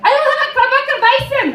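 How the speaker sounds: high, loud mock German demand; bossy German customer. Bartender imitating a demanding German customer. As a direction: bark it like a gruff tourist.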